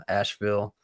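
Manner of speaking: usual speaking voice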